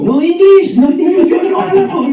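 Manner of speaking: high, loud yelps in broken language; hammed-up begging for mercy; mock pleading shouts. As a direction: yell it like a terrified soldier, played for laughs. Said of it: "Les gritaban".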